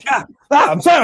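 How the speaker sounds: mock panic. Joking husband pretends his wife caught him, mock panic. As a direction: back down fast as if the wife just walked in.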